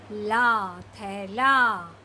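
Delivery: थैला drawn out slowly; slow reading. Shown as a demonstration. Teacher reading the joined word slowly. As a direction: stretch थैला out. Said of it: "धीरे धीरे पढ़ने की कोशिश करिए".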